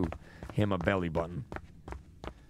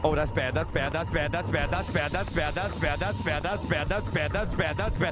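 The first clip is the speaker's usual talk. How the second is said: panicked; 4 semitones higher than usual. Real, not acted. Panicking after jump scare.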